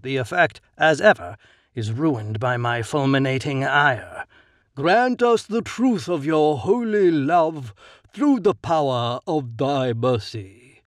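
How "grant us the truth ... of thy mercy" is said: slow, reverent chanting of a prayer; intoned prayer. A narrator's reading. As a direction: pray it slowly and devoutly. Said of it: "I realize it is a prayer".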